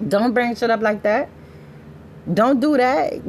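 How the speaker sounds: put-on scolding her boyfriend, high and heated; firm warning. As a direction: warn your man off, raising the voice. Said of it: "I had to tell my dude".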